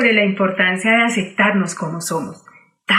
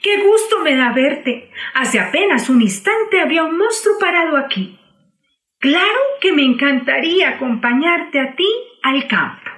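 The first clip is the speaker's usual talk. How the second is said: relieved delight; 6 semitones higher than usual. Acted. Cerda relieved and happy.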